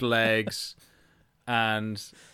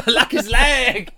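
high, loud, drawn-out howl; laughing high-pitched yell; gleeful shout; 13 semitones higher, 9 dB louder, much slower than usual. Real, not acted. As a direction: shriek the punchline back through laughter. Cracking up at leg joke.

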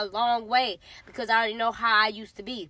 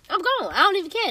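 pitched up high and louder, fired up; hyped up; 8 semitones higher, 4 dB louder than usual. Pretend. Bragging she'd take off, pitched-up excited. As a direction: throw it up high like she's already running. Trained to go, repeated.

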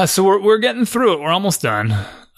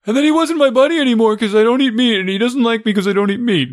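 imitating his son; 8 semitones higher, 3 dB louder than usual. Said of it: "He's like".

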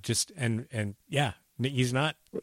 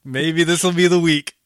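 put-on Belgian accent; 5 semitones higher, 9 dB louder than usual. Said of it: "I'm going to attempt, uh, Belgian".

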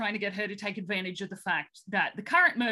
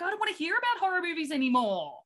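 higher, quick hammed-up protest; mock fed-up complaint; 7 semitones higher, faster than usual. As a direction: whine it like a captor who has had enough, jokingly. Imagined fed-up captors complaining.

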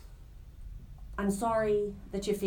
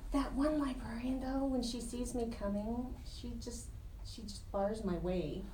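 hushed; 9 dB quieter than usual. Real, not acted. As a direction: lean in and keep it down. Hushed gossip about librarian.

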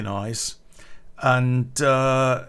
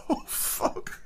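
high wheezing laugh; breathless laughter; 7 dB quieter than usual. Real, not acted. Teller recalls the handshake, wheezing laugh. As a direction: dissolve into helpless silent laughter.

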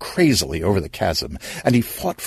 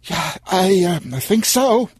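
higher, breathless and shaken; a hint of being out of breath; panting; 10 semitones higher than usual. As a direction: gasp it out after the fall. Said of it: "Stan panted".

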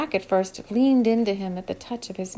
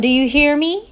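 a slight tremble, a little louder and higher; voice trembling slightly; 5 semitones higher, 8 dB louder than usual. A narrator's reading. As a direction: ask it with a faint shake of fear. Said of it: "with her voice trembling slightly now".

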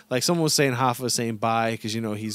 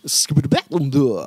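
louder, playful rapper impression; 3 semitones higher, 6 dB louder than usual. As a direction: burst out a nonsense rap catchphrase. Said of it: "Who, E forty?".

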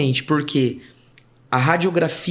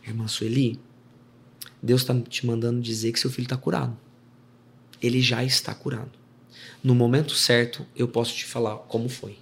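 solemn prophetic message; 5 semitones lower, 5 dB quieter than usual. Retold or imitated. Church sister delivers message to mother.

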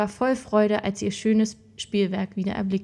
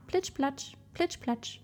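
higher, soft little splashy words; imitated splashing steps; 5 semitones higher, 7 dB quieter, slower than usual. A narrator's reading. Something creeps up the marble stairs, soft imitated splashing. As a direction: softly imitate wet footfalls, pitched up.

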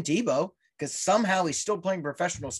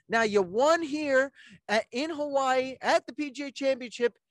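ordinary delivery

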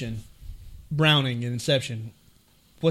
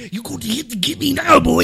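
put-on thick South African accent, much higher and far off his own voice; South African accent impression; 8 semitones higher, 3 dB louder than usual. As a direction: mimic the actor's mumbled South African accent. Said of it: "I can't even understand your dialogue".

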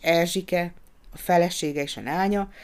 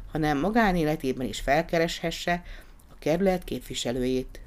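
ordinary delivery, nothing marked

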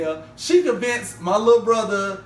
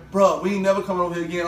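rattled off fast as the homeboy; brisk imitation of a friend; quick retold vow; 3 semitones lower, much faster than usual. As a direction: say it in one quick run. Homeboy's vow, then repeated back.

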